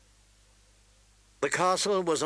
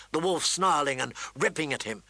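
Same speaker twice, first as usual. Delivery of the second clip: usual speaking voice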